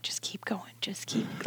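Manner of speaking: whispered retold aside; hushed urging. Nurse and doula to each other, whispered urging. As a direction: whisper it as a private signal.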